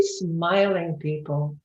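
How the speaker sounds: usual speaking voice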